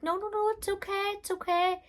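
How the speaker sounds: high, soothing no no no; reassuring. Retold or imitated. Midwives calming the frightened dad, high reassuring voice. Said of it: "they were like".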